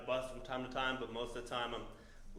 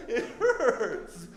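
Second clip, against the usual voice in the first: laughing hurt; 7 semitones higher, 6 dB louder than usual. Retold or imitated. Hurt after falling.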